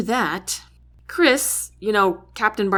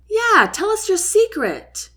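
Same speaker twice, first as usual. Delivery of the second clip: high, loud teasing shout; 13 semitones higher, 4 dB louder than usual. A narrator's reading. Young soldier taunting Jack, high loud shout. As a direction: call out to Jack across the table. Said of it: "shouts another".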